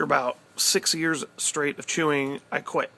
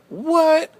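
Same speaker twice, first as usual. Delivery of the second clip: much higher, louder squeak of surprise; shocked what; 14 semitones higher, 8 dB louder than usual. Retold or imitated. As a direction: jump up in disbelief. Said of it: "And I was like".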